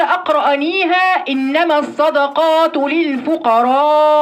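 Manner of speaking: slowly stretching the madd in الفقراء; الفقراء with a drawn-out final vowel; long madd on al-fuqara'. Shown as a demonstration. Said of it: "بالمد". (مد طويل في الفقراء)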